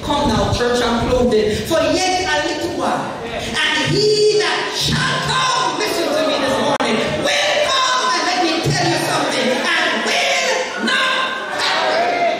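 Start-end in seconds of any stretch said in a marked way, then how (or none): 1.7-12.3 preaching at full force. Acted. Pastor closing the sermon to the church, preaching at full force. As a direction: lift the scripture up like a climax. Preacher reading scripture, climaxing.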